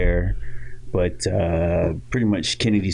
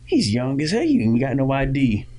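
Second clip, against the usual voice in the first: a touch higher mock bouncer; bouncer objecting; 6 semitones higher than usual. Security guy refusing the young son, lightly voiced. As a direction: object like a doubtful doorman. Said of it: "He's like".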